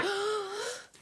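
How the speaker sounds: high gasp of delight; sharp high excited gasp. Real, not acted. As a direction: gasp as if seeing a treat. Delighted gasp at stamp set.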